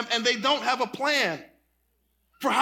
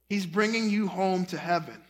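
usual speaking voice

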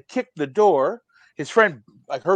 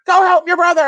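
loud, high mock yell; imitating nagging wife; 12 semitones higher, 5 dB louder, faster than usual. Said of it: "his wife's like".

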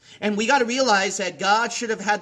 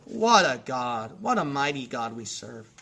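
reverent awe; 8 semitones lower than usual. Real, not acted. Preacher marvelling at God's grace, reverent and low. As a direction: let it sink low and unhurried in awe.

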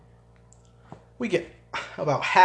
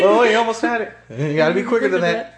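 teasing commercial guy; 7 semitones higher, 6 dB louder than usual. Retold or imitated. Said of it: "a dollar hooked on the end of a fishing line".

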